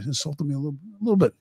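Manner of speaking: Goodfellas line. Retold or imitated. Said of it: "I stole his line from, uh, Goodfellas".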